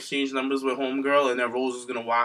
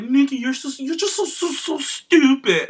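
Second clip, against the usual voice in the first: much higher, snotty mimicry of the insult; mocking high voice; 11 semitones higher, 5 dB louder than usual. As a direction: squeak it out like a bratty mean girl. Mocking Bree's insult to Nikki.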